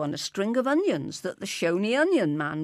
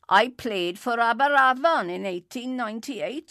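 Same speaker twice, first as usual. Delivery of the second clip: higher, loud character boast; boastful stranger; 4 semitones higher, 5 dB louder than usual. A narrator's reading. Stranger boasting to Enoch Davis about rugby, boastful. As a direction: brag it out loudly.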